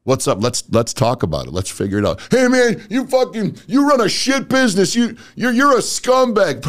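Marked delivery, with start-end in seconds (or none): 2.3-6.6 angry customer. Retold or imitated. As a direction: yell it at the salesman. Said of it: "customer yelling insults again".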